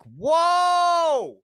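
high, loud shocked whoa. Retold or imitated. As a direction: yelp at the hundred-dollar price. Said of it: "I was like".

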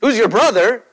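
a friend mock-outraged about family loyalty; indignant scolding. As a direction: scold him for disowning his own brother. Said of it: "you'd be like".

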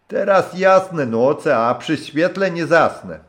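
put-on high, loud hearty old sailor's voice; a rough old skipper warming to his guest; gruff old captain. (serdeczny, szorstki głos starego kapitana)

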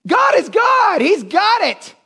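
high, shouted preaching declaration; impassioned proclamation. Real, not acted. Preacher declares to church that God is in control, high fervent cry. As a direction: cry it out with total conviction.

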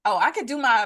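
ordinary talk, nothing marked

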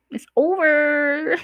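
"It's over" stretched slow and high, singing it out; drawn-out high sing-song. Shown as a demonstration. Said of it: "the relationship is over".

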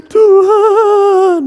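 high, loud sobbing wail to God; wailing cry. Retold or imitated. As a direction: wail it like a weeper at the altar. Imitates wailing prayer.

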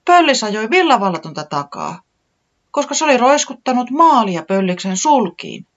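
high, loud urgent telling; excited explaining. A narrator's reading. Tiputi explaining the chases to Taputi, high and excited. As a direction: rattle it off, worked up. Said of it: "selitti Tiputi".

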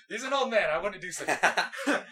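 laughing through the words; mock innocent while giggling. Real, not acted.

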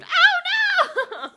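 high, very loud re-enacted wail; retold scream. Retelling retainers dropped in pet water bowl, comic scream. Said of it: "where I quite literally screamed".

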